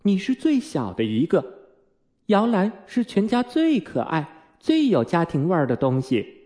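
persuading mother. A narrator's reading. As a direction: coax the youngest like a mum.

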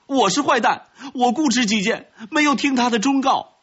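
high-pitched frantic outcry; agitated, high-strained child's shouting; loud desperate plea. Acted. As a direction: cry out a confession to stop an execution. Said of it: "他大声地说".

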